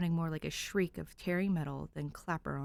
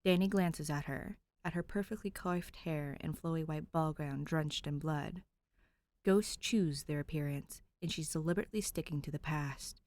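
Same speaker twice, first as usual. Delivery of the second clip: ordinary delivery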